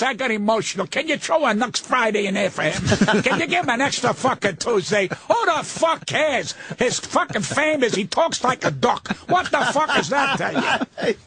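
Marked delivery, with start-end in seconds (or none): none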